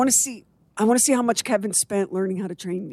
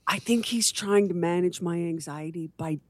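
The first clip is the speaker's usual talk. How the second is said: normal talking voice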